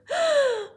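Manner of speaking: loud, high gasp; frightened gasp. Shown as a demonstration. Teacher showing pupils a gasp, loud and frightened. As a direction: gasp sharply, as if afraid. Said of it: "or I might gasp".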